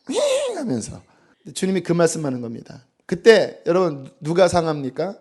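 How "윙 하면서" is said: high swishing whoosh; a sharp high swoosh of a hoof; whoosh of a kick. Retold or imitated. Preacher mimics the horse's kick, high whoosh. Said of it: "이렇게".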